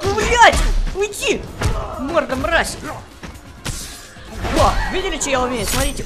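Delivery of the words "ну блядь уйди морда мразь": shrill, loud angry yelling; angry shout. Real, not acted. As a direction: bark it at the enemy fighter. Кричит на соперника. (сердитый крик)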